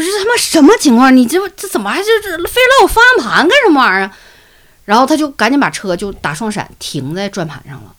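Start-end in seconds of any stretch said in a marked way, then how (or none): 0.0-4.2 high, loud startled yelling; shocked outburst. Retold or imitated. Boy yells at Yingying grabbing his steering wheel, high and loud. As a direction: yelp it out in panic as the wheel is grabbed.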